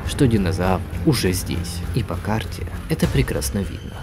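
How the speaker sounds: ordinary delivery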